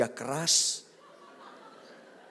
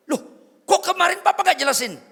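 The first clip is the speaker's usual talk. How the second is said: loud, high, rapid protest; imitated indignant wife; 13 dB louder, faster than usual. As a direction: play the wife firing back loudly.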